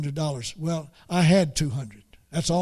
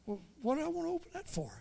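wife's voice; 10 semitones higher, 12 dB quieter, much faster than usual. Retold or imitated. Wife's puzzled reply voiced.

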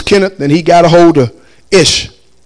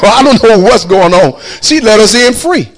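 usual speaking voice

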